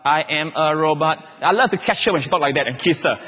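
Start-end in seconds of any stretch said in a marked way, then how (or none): 0.0-1.2 put-on child's game robot monotone. Three-year-old daughter playing her robot game, in monotone. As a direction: intone it evenly like a toy robot. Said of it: "She like to play this".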